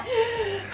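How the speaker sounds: sharp high gasp, half laughing; imitated gasp. Vlogger imitating her own breathing for viewers, high laughing gasp. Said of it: "so I'm like".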